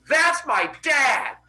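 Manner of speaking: high, loud, slow proclamation; proud shout. Acted. Boy pointing out his dad to bullies, proud shout. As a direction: holler it to the sky, every word stretched. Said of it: "points up at the sky and goes".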